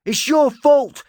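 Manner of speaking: very high, loud accusing scream. Acted. Talon screaming at Erasmus. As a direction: scream the blame at him. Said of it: "Talon screamed".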